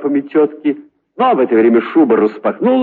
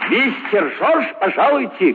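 крик; 8 semitones higher than usual. Acted. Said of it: "крикнул кто-то за дверью".

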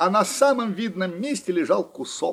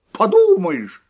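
louder scoffing cry; scornful exclaim; 5 semitones higher, 8 dB louder, slower than usual. A narrator's reading. Mouse scoffing at her friend's boast, louder. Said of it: "воскликнула Длиннохвостка".

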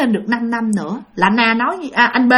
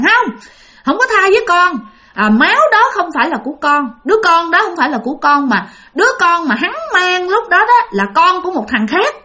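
higher, faster, heated denial; angry retort; 6 semitones higher than usual. Retold or imitated. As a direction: protest hotly, pitch up and rushing. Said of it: "anh B mới tức lên". (giận dữ cãi lại, giọng cao và nhanh)

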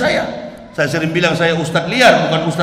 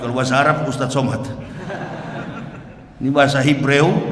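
normal talking voice

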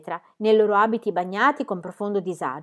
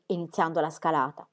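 usual speaking voice

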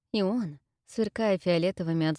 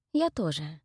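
ordinary delivery

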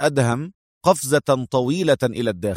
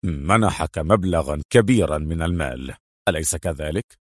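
restrained anger; 7 semitones lower than usual. A narrator's reading. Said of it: "قاطعه أدهم غاضبا".

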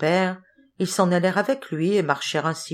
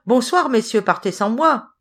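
higher, cheeky farewell call; gleeful call; 5 semitones higher, 4 dB louder than usual. A narrator's reading. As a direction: call a teasing goodbye, no real laugh. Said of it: "leur cria-t-il en riant".

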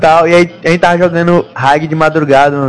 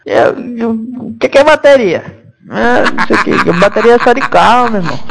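confused customer voice; 5 semitones higher than usual. Pretend. Customer confused about battery.

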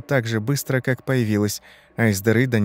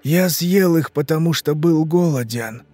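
higher, strained confession; anguished dying man; 5 semitones higher than usual. Acted. Rotting man confesses his hunger to Ivan, anguished. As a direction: plead it out miserably.

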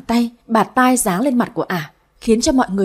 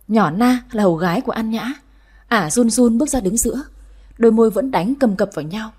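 ordinary delivery, nothing marked

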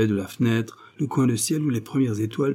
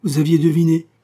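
high, louder startled question; flustered astonishment; 7 semitones higher, 6 dB louder than usual. A narrator's reading. Joseph realises Sénéchal has guessed, flustered astonishment. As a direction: gasp the question out, found out. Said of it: "murmura Joseph, devenant cramoisi".

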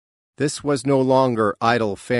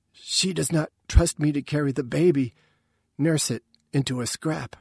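higher, lightly voiced mother; plaintive self-blame in a higher voice; dejected mother; 5 semitones higher than usual. A narrator's reading. Mother feeling untrusted with the baby, mildly plaintive.

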